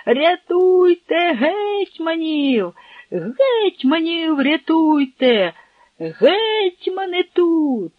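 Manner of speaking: high, slow, drawn-out cries; desperate calling out. Acted. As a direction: keep crying out to save the hetmans. Servant keeps calling for rescue.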